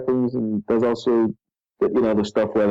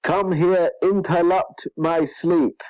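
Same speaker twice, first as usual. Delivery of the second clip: high, slow mock Chinese accent; caricatured Chinese-accented dragon, words drawn out; Lindfarn Worm; 5 semitones higher, slower than usual. Dragon scolding Jack for waking him, mock Chinese accent. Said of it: "this is phonetically how it's written".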